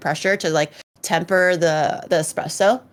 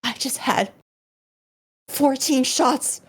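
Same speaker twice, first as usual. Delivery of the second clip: sheepish confession; 4 semitones higher than usual. Retold or imitated. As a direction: drag out the embarrassing admission. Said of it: "he was like".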